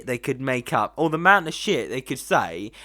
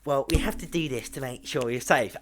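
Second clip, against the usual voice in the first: mock nurse voice; 5 dB quieter than usual. Mimics nurse justifying sanitizer.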